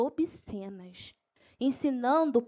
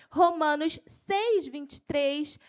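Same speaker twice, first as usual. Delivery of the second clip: keyed-up, raised verse reference; excited preacher listing verses; carried away; 2 semitones higher, 4 dB louder, slower than usual. Real, not acted. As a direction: announce the reference with rising enthusiasm. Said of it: "eu me empolgo".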